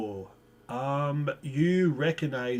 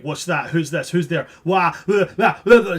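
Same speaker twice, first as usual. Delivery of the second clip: put-on panicked childlike voice; 3 semitones higher, 7 dB louder than usual. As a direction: imitate a little one's flustered panic noises. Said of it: "panic noises made by what you can tell to be quite a, a childlike voice".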